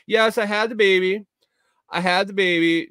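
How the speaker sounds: a hint of mock proud mom; imitated new mother.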